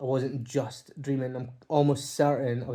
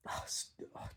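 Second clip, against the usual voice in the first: choked whisper; 17 dB quieter than usual. Real, not acted. Overcome remembering the hug.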